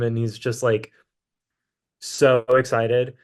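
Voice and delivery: ordinary delivery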